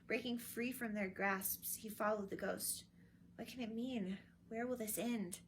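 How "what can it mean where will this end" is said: usual speaking voice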